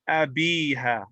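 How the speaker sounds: abiha with a long-held ee; long ya vowel. Shown as a demonstration. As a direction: stretch the bi in abiha. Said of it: "were majroor".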